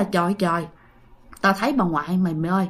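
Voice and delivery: low, hushed voice of a shaken aunt; shaken confiding. Retold or imitated. Aunt tells her niece she saw grandmother's ghost, shaken and hushed. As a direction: drop low and soft, as if confiding a fright.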